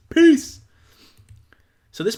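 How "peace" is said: high, loud sudden burst; mic-drop goodbye, pitched way up; emphatic sign-off. Acted. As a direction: belt out a triumphant farewell. Punchy sign-off to message.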